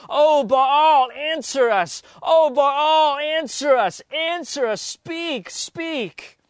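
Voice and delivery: frantic prophets calling. Acted. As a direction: beg an absent god to answer. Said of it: "they called upon the name of Baal".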